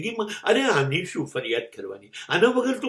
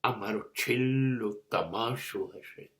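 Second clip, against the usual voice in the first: much softer and lower, dying away; fading farewell; 6 semitones lower, 8 dB quieter than usual. Acted. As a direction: let the voice fade as if leaving the world. Quiet farewell at the monologue's end. (અંતિમ વિદાયનો ધીમો, ઝાંખો પડતો અવાજ)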